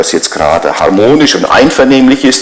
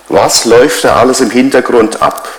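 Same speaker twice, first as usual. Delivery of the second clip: ordinary talk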